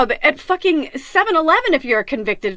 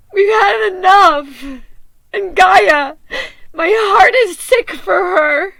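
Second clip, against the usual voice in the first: higher, louder, slow, voice breaking; crying; 5 semitones higher, 5 dB louder, slower than usual. Real, not acted. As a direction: sob it out in grief for the earth. Grieving for Gaia, crying.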